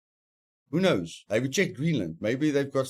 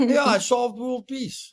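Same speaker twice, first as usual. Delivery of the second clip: smug boast; 10 semitones higher, 3 dB louder, slower than usual. Real, not acted. Jokes he solved world peace.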